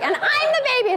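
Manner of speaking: very high mock toddler whine; put-on high child voice; little kid. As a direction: insist like an indignant small child. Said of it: "your little kid is going".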